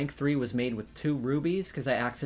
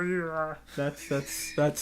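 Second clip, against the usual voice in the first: Amber Mammoth impression; 2 semitones higher, much slower than usual. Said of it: "That's your impression of Amber Mammoth?".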